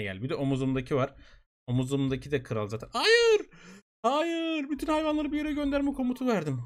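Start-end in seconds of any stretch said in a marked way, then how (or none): 2.9-3.4 high, loud shriek of dismay; real panicked "Hayır!" shriek at a game blunder; panicked shout. Real, not acted. Mistaken command sends animals away. 4.0-6.6 dismayed. Real, not acted. Realizing game mistake.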